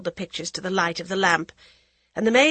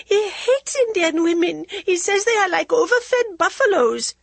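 high, loud tearful shout; 13 semitones higher, 6 dB louder than usual. A narrator's reading. Jaya shouts at the women, high and near tears. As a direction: near tears, she cries out her protest. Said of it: "Jaya shouted".

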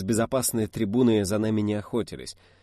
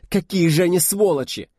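high, loud agonised cry; anguished outrage; 8 semitones higher, 7 dB louder than usual. A narrator's reading. Liza curses the shooters, agonised outcry. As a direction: cry out against them in pain. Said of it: "Голос её мучительно вибрировал".

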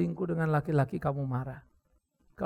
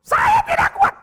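very high, very loud scream; shrieking shout; 14 dB louder, much slower than usual. Retold or imitated. Wife shrieking at pastor she is not strong, piercing shout. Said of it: "tuh kan kuat banget".

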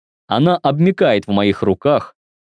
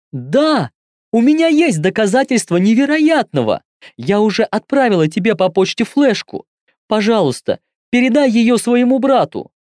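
put-on higher-pitched young woman, pleading; 10 semitones higher than usual. A narrator's reading. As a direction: voice her as a desperate girl. Caller pleads on phone.

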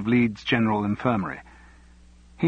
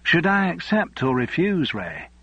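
broad Yorkshire wife, higher pitched; Yorkshire accent; 7 semitones higher than usual. Acted. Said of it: "in her broad Yorkshire accent".

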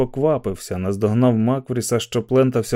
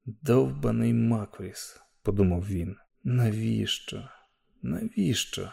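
hushed thought; 7 dB quieter than usual. A narrator's reading. Inner thought, hushed despair.

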